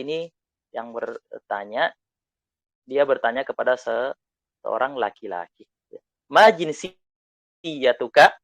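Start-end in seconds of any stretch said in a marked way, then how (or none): 6.3-8.3 "Ma jinsiyatuka?" drawn out very slowly, rising at the end; teacher drills "Ma jinsiyatuka?" for the class; slow model phrase. Shown as a demonstration. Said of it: "Diulang bersama saya".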